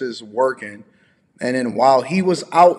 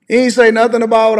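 higher, louder exasperated protest; indignant, raised voice; exasperated outburst; 9 semitones higher, 7 dB louder than usual. Real, not acted. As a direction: burst out in disbelief. Indignant rant about Steve's words.

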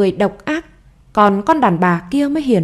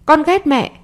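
put-on higher, childish cry of hate; child's outburst; 7 semitones higher than usual. A narrator's reading. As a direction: fling it at mum like a sulking boy. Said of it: "nó đẩy mạnh cô hơn".

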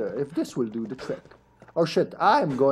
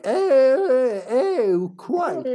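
high, strained little death grunts ending in a posh quite; mock dying groans; 9 semitones higher, much slower than usual. Player mimics the zombie's silly death sounds, high and strained. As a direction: ham up a zombie dying politely. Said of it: "he's dying like".

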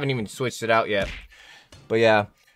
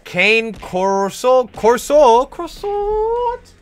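slow, climbing to a very high squeak on croissant; silly reading voice; 11 semitones higher, 7 dB louder, much slower than usual. Pretend. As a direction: mangle the breed name for a laugh. Mock-reading an odd dog breed.

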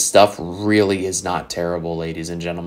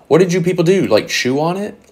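high-pitched, exasperated disbelief; mock outrage; 10 semitones higher, 4 dB louder than usual. Real, not acted. Vlogger scolding sellers of worn cards, mock outrage. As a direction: start loud and high, end on a rising question. Mocking condition of played cards.

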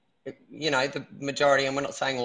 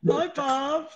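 much higher, sing-song greeting; 11 semitones higher than usual. Real, not acted. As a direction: lift the voice way up to greet Barb. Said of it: "I love your high voice".

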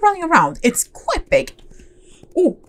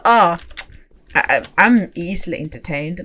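usual speaking voice

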